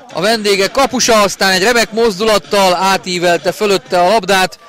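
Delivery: high, loud goal scream; excited hungarian sports commentary. Real, not acted. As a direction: erupt at the third goal. Live goal call.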